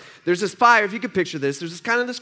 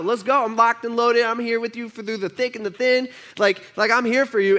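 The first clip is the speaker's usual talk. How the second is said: high, cocky bravado; boastful Peter; 6 semitones higher than usual. Acted. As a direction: swagger like an overconfident disciple. Peter boasts loyalty to Jesus.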